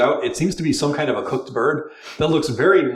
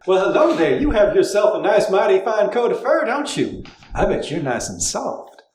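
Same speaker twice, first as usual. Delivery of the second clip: higher, flirtatious drawling woman; higher, coy Southern charm; flirty Southern belle; 7 semitones higher than usual. Acted. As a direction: lay on the sweet talk to the cat. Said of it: "trying not to sound like Null".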